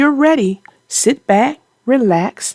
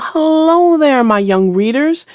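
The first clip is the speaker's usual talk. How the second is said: put-on bright storyteller hello to young readers; cheery greeting; 4 semitones higher than usual. Host greeting young readers, cheery. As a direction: welcome the kids in warmly.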